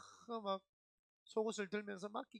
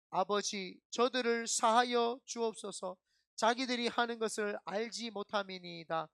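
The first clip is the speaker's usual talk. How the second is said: slow, louder reading of scripture; solemn scripture reading; 3 semitones higher, 7 dB louder than usual. Acted. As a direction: proclaim the verse slowly and fervently. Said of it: "천천히 삼십사 절 읽어보겠습니다".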